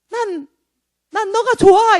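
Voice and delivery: high, loud clinging plea; Satan crying out. Acted. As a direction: play a devil who won't let go. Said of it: "사탄이 막 소리를 질러요". (매달리는 사탄처럼 높게 외치기)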